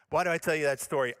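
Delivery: ordinary talk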